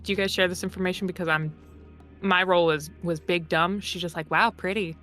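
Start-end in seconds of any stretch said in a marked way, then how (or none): none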